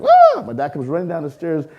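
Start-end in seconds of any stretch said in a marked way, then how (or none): 0.0-0.4 put-on high, loud shriek of a startled kid; panicked yell. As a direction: yelp as the outlet blows. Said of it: "'cause I was like".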